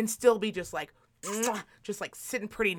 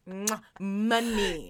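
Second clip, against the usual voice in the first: slow dreamy kiss; much slower than usual. Pretend. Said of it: "I'd be like".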